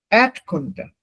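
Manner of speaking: Erdkunde with the accent on ERD; teacher's Erdkunde, stressed at the start; first-syllable stress. Shown as a demonstration. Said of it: "the first syllable".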